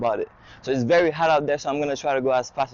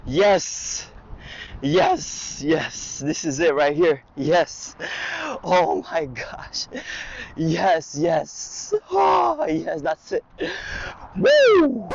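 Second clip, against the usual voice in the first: whooping with joy, high and loud; excited cheering; 4 semitones higher, 4 dB louder, much slower than usual. Real, not acted. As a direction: cheer like you just saw your dream car. Thrilled on seeing dream car.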